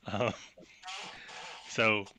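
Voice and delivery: ordinary delivery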